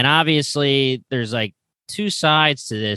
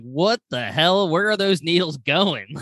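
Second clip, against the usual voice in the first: higher, drawn-out bewildered question; retold disbelief; 5 semitones higher than usual. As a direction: replay your baffled reaction, stretching the opening. Retells shocked reaction to needles.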